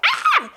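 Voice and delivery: short, very high mock scream of shock; sudden high yelp; retold scream. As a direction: recreate the scream from the phone call. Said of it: "like, screamed".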